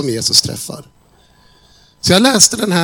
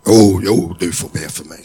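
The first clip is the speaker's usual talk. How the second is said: put-on a lower, gruff young man's voice; grudging lad, grumble it low; 10 semitones lower than usual. Sullen kitchen worker agreeing to prayer, low gruff grumble. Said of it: "ser lite burdus ut".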